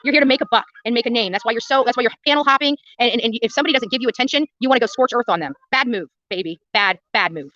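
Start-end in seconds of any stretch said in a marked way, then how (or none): none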